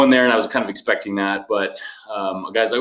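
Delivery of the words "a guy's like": ordinary talk, nothing marked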